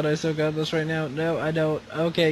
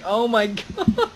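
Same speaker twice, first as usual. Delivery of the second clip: laughing exclaim; 5 semitones higher, 5 dB louder than usual. Real, not acted. Laughing while driving.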